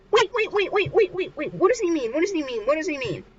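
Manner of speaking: high, rapid-fire repeated sputtering; mock frantic confusion. Girl baffled by his parting words, hammed-up fluster. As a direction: act out someone flustered and scrambling to understand. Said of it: "And just like".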